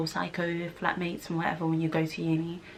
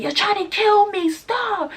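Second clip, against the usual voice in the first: high, louder mock terror; imitating terrified yell; 13 semitones higher, 6 dB louder, slower than usual. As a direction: squeal it like the frightened boyfriend. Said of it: "he's there like".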